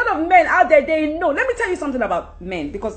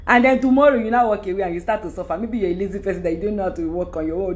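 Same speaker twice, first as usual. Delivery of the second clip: ordinary talk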